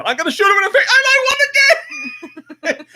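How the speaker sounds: high laughing shout of victory; excited shout. Retold or imitated. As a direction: yell it out, thrilled and laughing. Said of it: "and I said".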